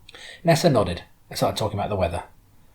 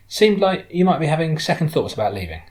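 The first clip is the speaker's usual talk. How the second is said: a higher, louder hint of the driver; lightly voiced woman driver, probing; 7 semitones higher, 5 dB louder than usual. Driver offering the departing passenger a way back, lightly probing. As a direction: gently probe as the friendly driver.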